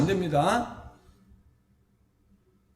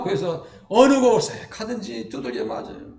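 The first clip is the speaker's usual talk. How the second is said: ordinary talk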